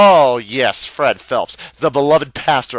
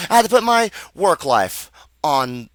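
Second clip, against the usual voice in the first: ordinary talk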